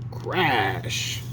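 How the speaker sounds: ordinary delivery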